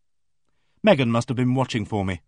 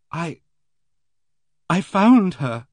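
shaking; 6 semitones higher, 5 dB louder, slower than usual. Acted. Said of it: "She began to shake".

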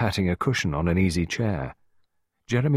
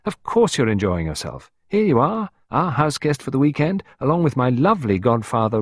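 put-on lightly raised, bright young hostess; a hint of Clarissa, pleased and airy; Clarissa's voice; 6 semitones higher, 4 dB louder than usual. A narrator's reading. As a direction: voice her as a cheerful young wife.

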